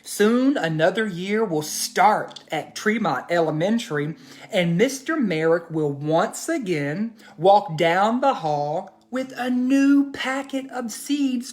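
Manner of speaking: put-on gentle storybook narration for kids; reading to children. A narrator's reading. Teacher reads story to children.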